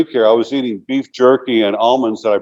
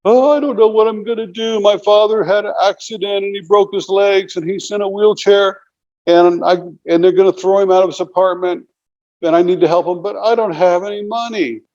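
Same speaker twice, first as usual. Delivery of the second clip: high, tearful plea, imitating a scammer; retold fake tears, pitched way up; fake crying; 9 semitones higher than usual. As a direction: mimic her put-on weepy sob story, much higher. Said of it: "she starts crying".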